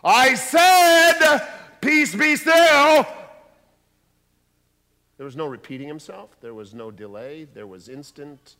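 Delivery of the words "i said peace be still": very loud, high, slow insistence; booming repeated command. Shown as a demonstration. The repeated command Jesus never needed, shouted. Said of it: "Jesus didn't say".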